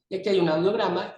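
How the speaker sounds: ordinary talk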